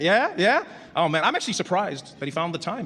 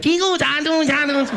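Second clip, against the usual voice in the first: high sing-song chime noises; chime imitation; 7 semitones higher, 3 dB louder, slower than usual. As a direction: mimic a high airport chime. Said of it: "they'll be like".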